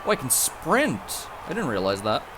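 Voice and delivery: ordinary talk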